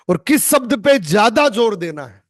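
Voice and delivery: "ज्यादा जोर" punched high and loud; emphatic line. Shown as a demonstration. Coach drilling students on word stress, emphatic. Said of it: "या तो मैं ये बोलूंगा".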